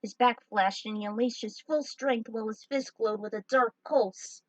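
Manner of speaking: ordinary delivery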